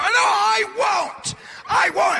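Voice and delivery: put-on high, slow whining kid, close to crying; a bratty kid crying no, dragging it out; whiny kid refusal. Kid whining back at mom, high and slow.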